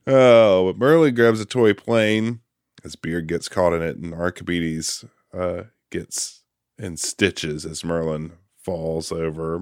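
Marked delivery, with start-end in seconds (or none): none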